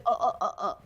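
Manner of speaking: imitating a flustered man's stuttering; awkward stammer. Man trying to return a hug, awkward. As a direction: stumble over repeated little grunts. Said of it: "he's, like, so awkward".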